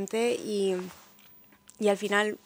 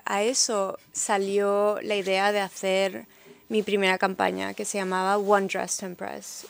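normal talking voice

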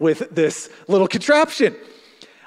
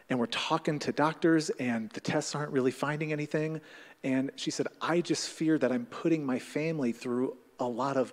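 much softer, lower and subdued retelling; quiet grief; 4 semitones lower, 9 dB quieter than usual. Retold or imitated. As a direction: speak it gently like a hurting woman. Grieving neighbor after two miscarriages.

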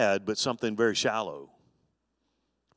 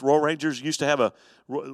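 ordinary talk

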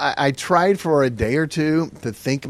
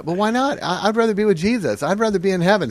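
put-on higher-pitched, earnest little boy; pleading up high, guileless son; eager child; 7 semitones higher than usual. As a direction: argue back like an innocent kid. Child eagerly argues with his dad.

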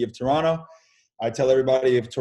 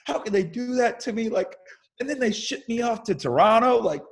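hammed-up whiny complaint, much higher; half-laughing mock sob story; mock whining; 10 semitones higher than usual. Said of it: "I basically crying to my parents saying".

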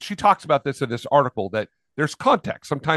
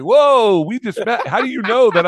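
mock shock; 5 semitones higher, 5 dB louder than usual. Mock shocked reaction to stranger.

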